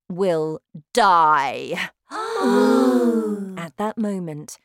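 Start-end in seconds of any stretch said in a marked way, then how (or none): 0.1-1.9 slow, loud commanding witch queen; shouting witch. Acted. Witch's gleeful ending. 2.1-3.6 witches gasp. Acted.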